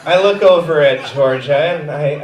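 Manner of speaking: ordinary talk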